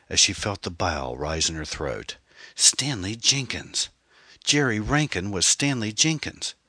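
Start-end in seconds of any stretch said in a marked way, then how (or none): 2.5-6.5 higher, emphatic voice of sudden discovery; shocked realisation. A narrator's reading. Anne works out who Jerry really is, stunned. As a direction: let the name land as a stunned revelation.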